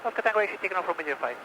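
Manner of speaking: quick, clipped radio transmission; radio call. Real, not acted. Instructor calling the tower, brisk radio-call voice. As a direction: rattle off the call like a pilot on air.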